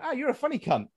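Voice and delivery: a hint of a friendly Scottish cop; imitating officer. Police officer greets comedian, lightly voiced.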